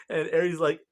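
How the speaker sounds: ordinary talk, nothing marked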